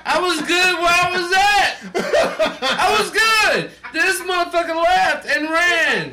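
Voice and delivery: high, loud, incredulous protest; comic outrage. Real, not acted. Storyteller complaining that his friend left and ran, outraged.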